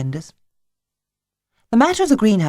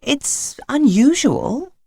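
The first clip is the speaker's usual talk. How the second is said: slow, doubtful and higher; 9 semitones higher, much slower than usual. A narrator's reading. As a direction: draw it out with doubt. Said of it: "said Missus Thornton doubtfully".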